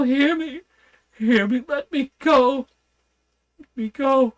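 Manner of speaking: high, loud frantic begging; a madman begging on his knees for release; desperate pleading. A narrator's reading. Madman on his knees begging the doctor to free him, frantic high pleas. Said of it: "poured forth a torrent of entreaty, with tears rolling".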